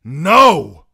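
high, very loud refusal; explosive defiance; defiant shout. A narrator's reading. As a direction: shout down the surrender. Knight refuses to surrender.

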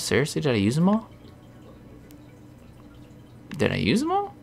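rising disbelief; real incredulous rise. Real, not acted. Can't find repels.

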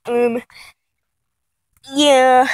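normal talking voice

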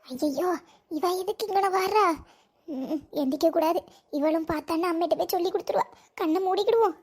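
low, soft hushed mutter; hushed aside. Acted. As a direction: keep it down so she won't hear. Hiding, pretending to sleep.

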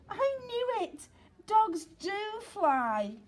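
high, loud and slow, bursting with joy; a pup's delighted cry of discovery; joyful cry. Acted. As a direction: shout it like the best news ever. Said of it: "he cried".

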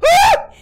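very high, loud shriek; startled scream. Real, not acted. Gamer attacked while picking up item, startled scream. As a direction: scream as if jumped.

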